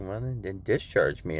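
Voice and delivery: ordinary delivery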